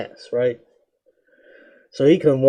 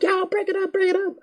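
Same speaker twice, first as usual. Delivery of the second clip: much higher yelling teacher voice; teacher in the hall splitting up the fight, pitched way up; teacher breaking up fight. Retold or imitated. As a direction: squeak out the order to break it up.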